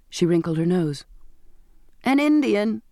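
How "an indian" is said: higher-pitched, gleeful tease; amused exclamation. A narrator's reading. Albert teases his sister's looks, amused. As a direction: blurt it out, teasing, without laughing. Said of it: "Albert laughed".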